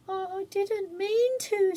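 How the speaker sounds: put-on high, slow, tearful girl; plead, close to crying; nearly in tears. A narrator's reading. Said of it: "nearly in tears".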